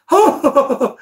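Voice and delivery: short hammed-up high laugh; overdone cheerful chuckle; mock laugh. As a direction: fake a beaming laugh. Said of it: "Und dann so lachend".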